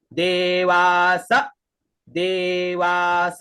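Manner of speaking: देवास with a light e, drawn out slowly; light e sound. Shown as a demonstration. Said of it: "इसे यहाँ पर हल्का ए का उच्चारण करेंगे". (देवास धीरे, हल्के ए के साथ)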